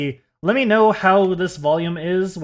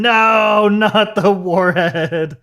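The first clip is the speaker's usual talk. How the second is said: dramatic wail; 4 semitones higher, 5 dB louder, much slower than usual. Real, not acted. Candy lost, mock despair.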